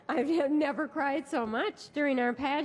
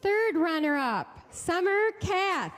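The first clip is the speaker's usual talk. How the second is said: announcer name reveal; 5 semitones higher than usual. Acted. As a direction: stretch the name for the crowd. Emcee announcing runner-up names.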